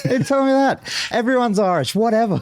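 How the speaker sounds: very high mimicked dismissal; full-on Irish woman. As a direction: overreact as an indignant Irish woman. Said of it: "react as full on as this one Irish woman".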